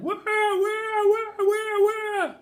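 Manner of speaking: slow high wah-wah howl; baby yelling. Acted. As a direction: wail at the sight of the needle. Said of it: "it yelled as loudly as it could".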